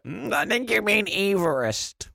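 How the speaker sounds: high, slow, put-on pedantic correction; mock pedant in the pub; pub know-it-all. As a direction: correct them smugly, drawing out EEV-rist. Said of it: "you can be that person in the pub".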